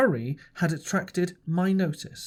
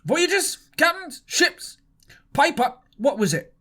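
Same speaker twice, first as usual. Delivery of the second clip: Silver pressing Morgan; 3 semitones higher than usual. A narrator's reading.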